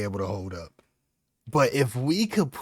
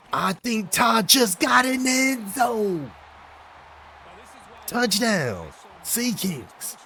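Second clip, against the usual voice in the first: excited; 8 semitones higher, slower than usual. Real, not acted. Player watching a long run.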